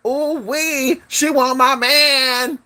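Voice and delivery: high, drawn-out mock gloating; teasing high-pitched imitation; imitating a boastful girlfriend. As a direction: mimic her crowing, high and slow. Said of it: "So she's like".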